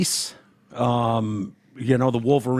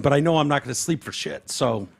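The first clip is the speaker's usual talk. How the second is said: normal talking voice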